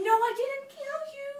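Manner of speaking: high, loud pleading protest; shrinking from blame, high and loud; distressed denial. Acted. Protests imagined accusation of killing.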